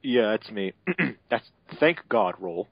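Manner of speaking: ordinary delivery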